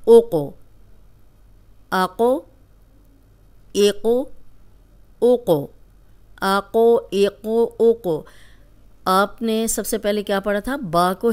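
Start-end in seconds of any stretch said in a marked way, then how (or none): 1.9-8.2 आको एको ओको with the क bounced, a shaken echo on the stop; qalqalah bounce. Shown as a demonstration. Teacher drilling students, bouncing the क in आको. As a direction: shake the क after each vowel. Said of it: "इसको हिला के पढ़ेंगे".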